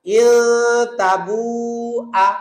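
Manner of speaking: ইল তাবু আ with a short আ; correct recitation. Shown as a demonstration. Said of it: "তাহলে কি বলবো?".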